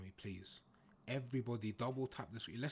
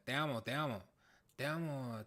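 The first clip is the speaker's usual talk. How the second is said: usual speaking voice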